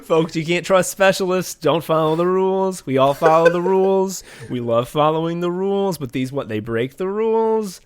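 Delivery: hammed-up politician complaining to his supporters; Trump. Politician ranting to a rally crowd about rules, mock impression. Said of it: "when he's like".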